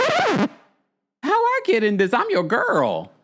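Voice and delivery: very high-pitched imitation of indignant surprise; mock bewildered protest. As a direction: mimic a squeaky, baffled objection. Brandy, suddenly called out, protests.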